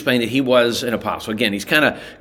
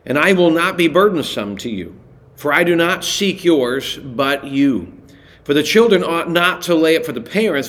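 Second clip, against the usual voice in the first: raised, earnest reading of the verses; lifted Bible reading voice; scripture reading; 4 semitones higher than usual. Acted. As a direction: read the passage out with weight. Reads verse fourteen aloud.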